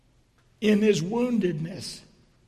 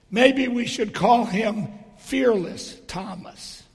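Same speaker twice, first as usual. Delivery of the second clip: ordinary talk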